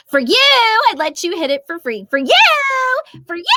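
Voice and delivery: put-on voice from a popular sound clip; exaggerated catchphrase delivery; viral audio impersonation. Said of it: "impression of that".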